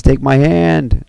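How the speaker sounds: high, drawn-out cry for someone to grab on; acted rescue cry; desperate call. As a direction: call out, stretching the words. King reaching for falling Yuji.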